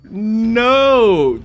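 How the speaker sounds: high, loud, long-drawn no; mad wail. Real, not acted. Gamer furious at being set up, long loud wail. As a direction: wail it out in real frustration. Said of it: "I am so mad".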